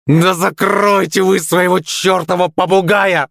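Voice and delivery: high, exasperated yell without much volume; angry yell. A narrator's reading. Blond boy losing the ball to rivals, exasperated high yell. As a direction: cry out in high-pitched exasperation. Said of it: "закричал Белобрысый".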